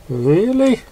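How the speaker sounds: high, rising disbelief; surprised question. A narrator's reading. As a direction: ask it wide-eyed and bouncy. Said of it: "said Pinkie".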